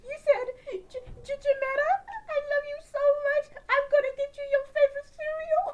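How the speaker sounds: high squeaky silly dad voice; high goofy dad voice. Acted. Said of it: "let me finish this little dialect".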